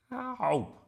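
high, loud "ow"; pained cry. A narrator's reading. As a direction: yelp as if poked. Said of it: "cried a voice".

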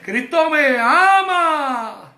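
exultant, slow preacher's shout; shouted praise. Shown as a demonstration. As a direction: shout it out to the Lord, stretching each word.

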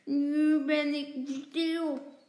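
slow, loud muffled words; mouth full. A narrator's reading. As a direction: talk round a mouthful of sweets. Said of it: "met volle mond zegt hij".

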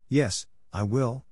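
slow, quavering yes; a touch of fear in the promise; trembling voice. A narrator's reading. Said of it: "in a trembling voice".

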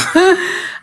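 real laugh breaking out high; high burst of laughter. Real, not acted. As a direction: laugh at the absurd rejection. Laughing at own story.

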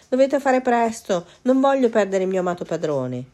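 hammed-up pleading cat; dramatic lament. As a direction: overplay the worry for the servants. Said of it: "si lamentò con fare drammatico".

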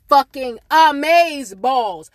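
slow, loud stretched words; drawn out emphasis. Real, not acted. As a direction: stretch each word out loudly. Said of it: "drawn out emphasis".

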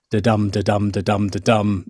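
even da-dum rhythm, weak then strong; steady sing-song meter, da-DUM da-DUM; iambic beat. Shown as a demonstration. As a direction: tap out the iambic beat, stressing each dum. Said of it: "nice kind of even rhythm".

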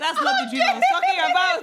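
very high, loud protest through laughter; laughing protest. Real, not acted. Denying the sexual dream to friends, laughing protest. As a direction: squeal the denial while laughing. Embarrassed denial amid laughter.

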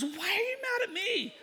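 very high-pitched protest; hammed-up wounded surprise; mock bewildered. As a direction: squeak out a baffled comeback. Retold quarrel, Ed's baffled reply.

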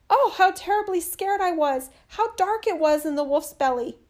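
high, loud frightened cry; scared crying out. A narrator's reading. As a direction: blurt it out, still terrified. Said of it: "jumped out crying".

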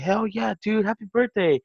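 high, hyped-up retold birthday cheer; enthusiastic congratulation. Acts out stranger congratulating him.

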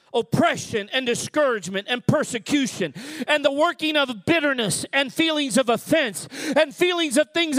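fervent sermon voice, building in force; preaching. Acted. Pastor warning the church of coming attacks, fervent preaching. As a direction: preach it to the congregation with conviction.

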